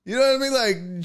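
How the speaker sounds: ordinary delivery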